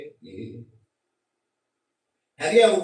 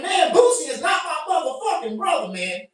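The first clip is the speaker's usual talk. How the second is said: indignant denial; 7 semitones higher, 4 dB louder than usual. Retold or imitated. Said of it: "I'm like".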